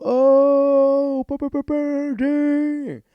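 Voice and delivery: high, loud jeering ooh; rowdy high school class. Acted. Class reacting to Nathan's insult, rowdy high whooping. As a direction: whoop like a classroom egging on a fight. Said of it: "the class, typical high school".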